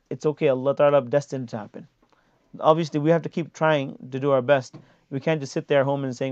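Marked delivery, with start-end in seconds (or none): none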